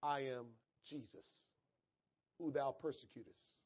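low, soft restatement of the divine words; subdued, measured quote; quiet quotation. Retold or imitated. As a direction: say it hushed and low, with pauses. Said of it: "The voice said".

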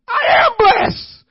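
loud, high, slow cry; preacher shouting it slowly; shouted declaration. Acted. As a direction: proclaim it with full force. Answering the mock quote, shouted.

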